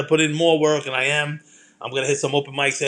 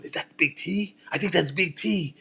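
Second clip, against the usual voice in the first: lightly put-on German accent, small hushed kid voice; German kid's accent; 3 semitones higher, 6 dB quieter than usual. Little German kids spotting Big T on the stairs, imitated German accent. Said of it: "Like, in his German accent".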